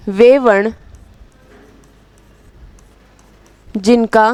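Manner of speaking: slow dictation, phrase by phrase with long pauses; measured words spaced out for note-taking. Real, not acted. Teacher dictating a definition for students to write, slow dictation.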